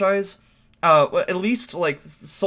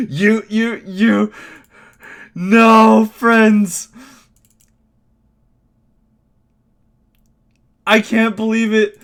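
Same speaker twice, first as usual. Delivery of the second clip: high, loud, mock-accusing complaint; loud exasperation; 6 semitones higher, 8 dB louder, slower than usual. Real, not acted. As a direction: blame the controller loudly. Still upset at the game.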